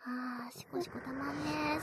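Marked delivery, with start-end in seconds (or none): 0.0-0.6 whisper. Acted. Whispered sigh in erotic roleplay.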